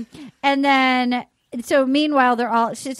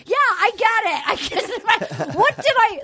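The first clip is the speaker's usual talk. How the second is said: high-pitched indignant mock protest; [name]; 7 semitones higher, faster than usual. Wronged gentleman complaining to everybody, mock outrage. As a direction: plead his case in a raised, exasperated voice.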